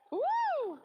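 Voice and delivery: loud, high-pitched "woo"; hype whoop. Real, not acted. Host kicks off the face-off, excited whoop. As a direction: whoop to rev up the crowd.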